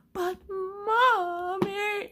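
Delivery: high, drawn-out mock wail; hammed-up squeaky crying whine; child bursting into tears. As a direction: imitate a little girl wailing, stretching each word. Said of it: "she just starts going at it. The waterworks".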